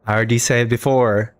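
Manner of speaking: normal talking voice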